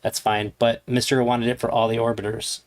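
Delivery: usual speaking voice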